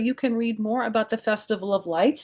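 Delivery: ordinary delivery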